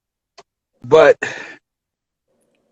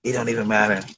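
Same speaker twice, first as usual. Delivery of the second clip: sheepish mumble; 7 semitones lower, 9 dB quieter than usual. Retold or imitated. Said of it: "He's like".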